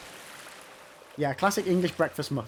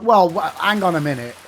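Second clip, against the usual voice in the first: mock indignant protest; 7 dB louder than usual. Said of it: "And you'd be like".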